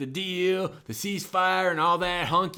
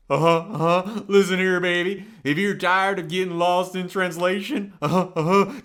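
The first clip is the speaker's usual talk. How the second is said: louder [name] croon with uh-huh grunts; swaggering [name] ad pitch; [name] impression; 3 dB louder than usual. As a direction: ham up the King selling a service. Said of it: "just like [name] himself".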